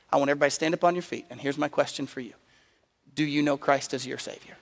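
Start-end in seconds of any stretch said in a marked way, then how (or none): none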